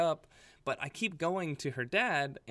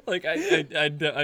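high loud laughter breaking words; can barely speak for laughing; laughing hard; 4 semitones higher, 12 dB louder than usual. Real, not acted. Host laughing while retelling the party story.

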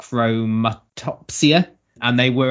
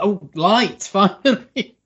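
higher, laughing "finally"; relieved delight; 8 semitones higher, 4 dB louder, slower than usual. Retold or imitated. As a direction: act relieved, a touch of mock joy. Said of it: "I was like".

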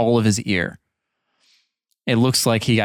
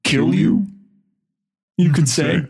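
menacing villain; 5 semitones higher than usual. Acted.

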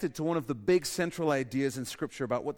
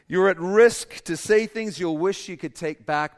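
full-force preaching; 7 semitones higher, 12 dB louder than usual. Acted. Preacher builds to a climax.

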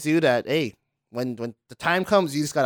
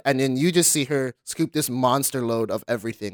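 ordinary talk, nothing marked

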